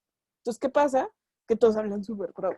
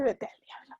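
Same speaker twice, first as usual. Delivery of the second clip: "Vete al diablo" whispered with no voice; 5 dB quieter than usual. Shown as a demonstration. Timid writer barely daring to curse, whispering. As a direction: breathe "Vete al diablo" under your breath.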